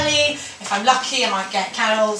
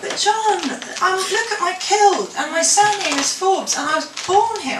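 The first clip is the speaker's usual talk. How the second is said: put-on higher-pitched voice of a child pleading; protesting child; 8 semitones higher than usual. Girl insisting to her schoolmate that she's Scottish, high-pitched protest.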